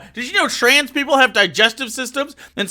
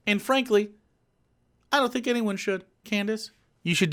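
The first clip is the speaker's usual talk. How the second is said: much softer, dropped-away mutter; muttered aside; 13 dB quieter than usual. Real, not acted. Insulting Candace quietly, a soft muttered jab. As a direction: mumble the dig under your breath.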